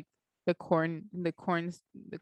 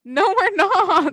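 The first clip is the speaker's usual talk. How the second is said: loud playful protest; 10 semitones higher, 12 dB louder, slower than usual. Real, not acted. Playful denial of high school.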